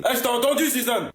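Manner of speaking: high-pitched, offended outburst; indignant call-out. Acted. Man catching a teammate's jibe, indignant high-pitched call.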